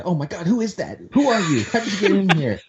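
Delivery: mock alarm at a stranger in the house; startled wife. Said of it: "and my wife said".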